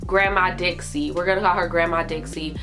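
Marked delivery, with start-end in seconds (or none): none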